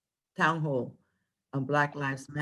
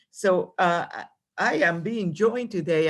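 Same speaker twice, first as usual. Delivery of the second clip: normal talking voice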